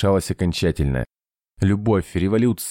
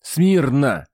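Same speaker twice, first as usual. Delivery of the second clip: shouted command; 9 semitones higher than usual. A narrator's reading. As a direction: call the troops to attention. Command echoing down corridor.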